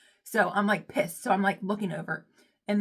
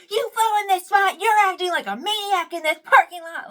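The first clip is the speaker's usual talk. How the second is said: high, loud accusing rant; hammed-up hysterical tirade; unhinged yelling; 11 semitones higher, 9 dB louder than usual. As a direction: shout like you've completely lost it. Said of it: "an unhinged bitch".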